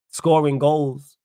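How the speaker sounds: usual speaking voice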